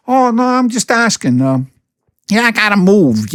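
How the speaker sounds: high, innocent put-on voice; mock coy hint. Mimicking the friend hinting at a move, coy high voice. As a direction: drop the hint in a sugary voice.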